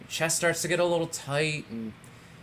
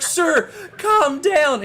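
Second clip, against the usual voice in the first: high, slow, drawn-out command; firm paramedic; 11 semitones higher, 4 dB louder, much slower than usual. Acted. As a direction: order a panicking patient to settle. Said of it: "they're like".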